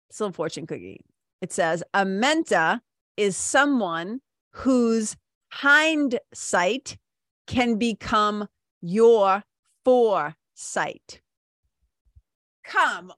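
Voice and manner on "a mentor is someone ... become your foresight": very slow, weighty reading aloud; slow deliberate reading. Shown as a demonstration. Host reading the fortune cookie slowly to listeners. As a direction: read it out slowly, letting each phrase land. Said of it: "I'll say it again".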